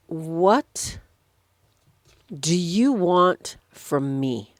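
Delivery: drawn out with long pauses; slow, weighty question. Retold or imitated. Jesus asks the blind man what he wants, slow and deliberate. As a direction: ask it slowly, word by word.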